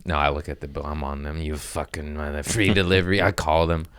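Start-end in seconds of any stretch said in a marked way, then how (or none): none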